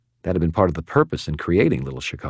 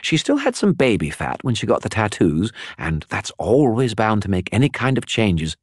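higher, chattering spirit voice; a talkative skull spirit, pitched up; Bob the skull; 5 semitones higher than usual. Acted. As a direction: prattle on like a gossipy spirit. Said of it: "Bob the skull speaks".

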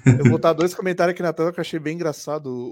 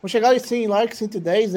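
excited appeal; 6 semitones higher, 4 dB louder than usual. Real, not acted. Calling viewers to like.

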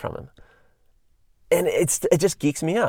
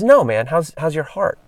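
pointed question; 5 semitones higher, 4 dB louder than usual. Retold or imitated. Larry clarifying his question.

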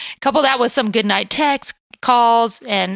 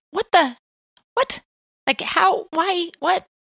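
shocked disbelief; 8 semitones higher, slower than usual. Retold or imitated. Retold shocked reaction.

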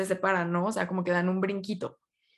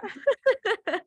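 high giggle; 11 semitones higher than usual. Real, not acted. Laughing at the story.

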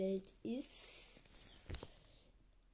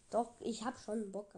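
ordinary delivery

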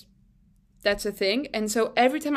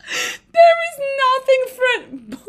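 high, loud and slow through tears; wailing it out mid laughing fit; sobbing with laughter; 14 semitones higher, 7 dB louder, slower than usual. Real, not acted. Laughing breakdown.

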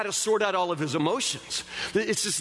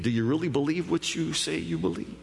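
ordinary talk

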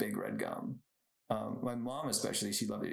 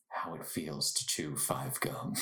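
lower, slow, breathy commercial voiceover; breathy gum ad voice; 7 semitones lower, slower than usual. Retold or imitated. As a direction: mimic a moody late-night gum ad narrator. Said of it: "some really breathy voice".

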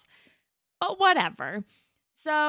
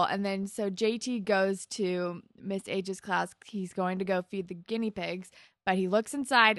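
normal talking voice

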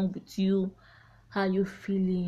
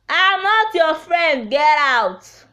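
higher, louder, slow angry brush-off; angry dismissal; 7 semitones higher, 7 dB louder, slower than usual. Retold or imitated. Telling off a fake friend who writes nonsense, loud and angry.

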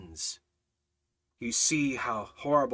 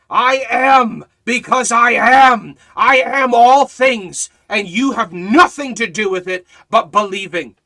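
full-force preaching; 10 semitones higher, 16 dB louder than usual. Acted. Preacher proclaiming Jesus's words to the congregation, booming. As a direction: thunder each I am from the pulpit.

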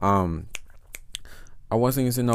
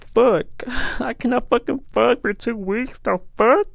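high-pitched, laughing complaint; laughing mock whine; 11 semitones higher, 4 dB louder than usual. Retelling his frustration, laughing.

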